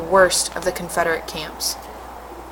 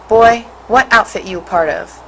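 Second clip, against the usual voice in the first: loud, harsh barked question; gruff soldier barking an order; barked demand; 2 semitones higher, 6 dB louder than usual. A narrator's reading. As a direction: bark it at the boy like an order. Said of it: "the leader barked".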